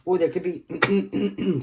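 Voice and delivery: rough, tired voice cracking; hoarse voice. Real, not acted. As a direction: voice giving out, slow and strained. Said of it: "I'm losing my voice now".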